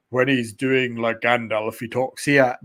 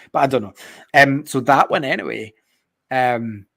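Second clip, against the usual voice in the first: usual speaking voice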